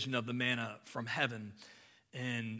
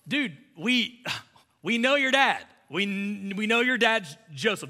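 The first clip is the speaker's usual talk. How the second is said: higher, louder mocking brush-off; dismissive jeer; 6 semitones higher, 5 dB louder than usual. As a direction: scoff at him like a know-it-all. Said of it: "So they're like".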